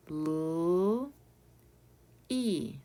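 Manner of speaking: "L" held long, then "e" as a separate ee; sounded out. Shown as a demonstration. Teacher prepares learners to spell, "L, e" sounded out. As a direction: isolate the sounds in "L, e" with a gap. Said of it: "How do we spell them?".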